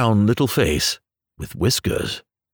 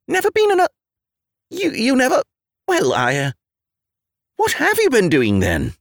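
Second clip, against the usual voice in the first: flustered disbelief; 14 semitones higher than usual. Acted. Rat flustered, stammering.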